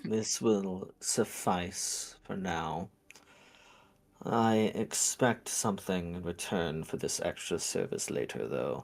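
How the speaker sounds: summoned bicorn. Acted. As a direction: speak as a measured creature naming its terms. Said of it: "looks at you and is like".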